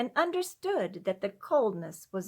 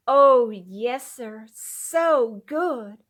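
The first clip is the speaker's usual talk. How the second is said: louder, then drawn out slowly on so good; grateful satisfaction; 3 semitones higher, 9 dB louder, much slower than usual. A narrator's reading. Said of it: "satisfaction mingled with gratitude was in her voice".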